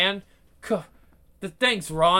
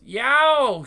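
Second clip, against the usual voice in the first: yelp; 6 semitones higher, 7 dB louder than usual. Acted. Pained yelp in fight.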